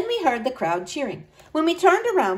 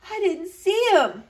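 sniffing; 9 semitones higher than usual. A narrator's reading. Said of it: "I said, sniffing".

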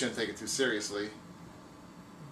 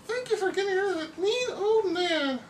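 mock weepy thanks; 5 dB louder than usual. Damsel thanks her hero.

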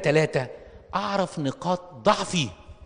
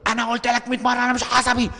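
high, loud furious yell of "مش عصبي"; angry shout; 9 semitones higher, 5 dB louder than usual. Retold or imitated. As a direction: acts out a man yelling that he is not short-tempered, furious shout. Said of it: "وبعدين مرة يقول".

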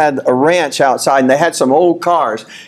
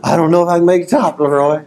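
breathless self; 2 semitones higher than usual. Retold or imitated. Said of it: "and I'm like".

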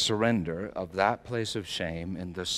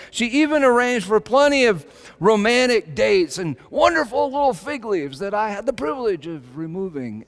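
higher, louder, driving sermon voice; full-force preaching; 11 semitones higher, 9 dB louder than usual. Acted. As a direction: preach it out, building fervor.

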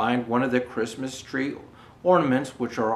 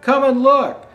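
louder, higher calling out; excited children's shout; 10 semitones higher, 10 dB louder than usual. Acted. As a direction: call the parents from the window. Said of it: "they shout".

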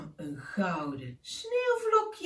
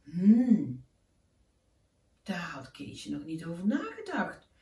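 ordinary delivery, nothing marked

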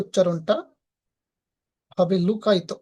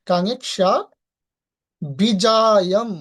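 Vijayam drawn out very slowly and louder; 5 dB louder, much slower than usual. Shown as a demonstration. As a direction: stretch Vijayam out long. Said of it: "ভেঙে ভেঙে উচ্চারণ".

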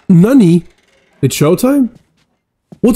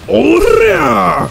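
battle cry; 9 semitones higher than usual. Pretend.

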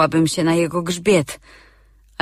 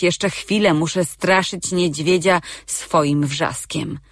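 ordinary delivery, nothing marked

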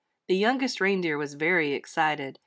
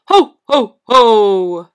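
big booming ho-ho-ho; belly-laugh it out as Santa; very loud, jolly Santa laugh; Santa's laugh; 15 dB louder, much slower than usual. A narrator's reading. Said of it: "laughed Santa".

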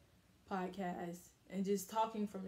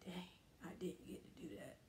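whispered regret; 12 dB quieter than usual. Retold or imitated. Regret voiced after dying.

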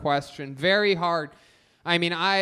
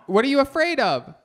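usual speaking voice

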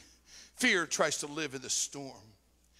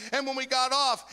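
ordinary talk

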